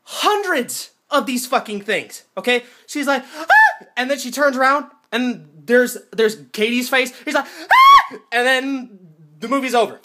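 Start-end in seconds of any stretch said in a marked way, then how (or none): 3.4-3.9 high, loud mock shriek; short imitation of a heroine's scream; frightened scream. Heroine sees hundreds of them. 7.4-8.2 very high, loud mock shriek; final horror scream. Heroine sees Katie's face.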